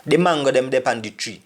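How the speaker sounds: quick, run-together Patois; fast like a Jamaican. Shown as a demonstration. Teacher showing learners how fast Jamaicans talk, fast. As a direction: rattle the whole sentence off at native speed. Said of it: "if I speak like Jamaican so fast".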